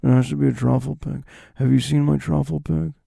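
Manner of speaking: understated, held-back loner impression; Nick Cage. Pig owner insisting on truffle pig, understated impression. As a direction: answer evenly, underplaying every line. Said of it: "Nick, couldn't you just have any pig?".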